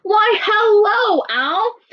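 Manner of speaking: very high, drawn-out greeting; mouse hailing. Acted. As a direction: sing out a loud hello.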